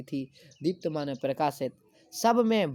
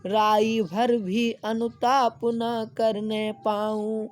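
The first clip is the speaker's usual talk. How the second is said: slow, high, loud declaiming; slow lofty verse reading; poem recitation; 6 semitones higher, 4 dB louder, much slower than usual. Acted. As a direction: chant the verse slowly to the class.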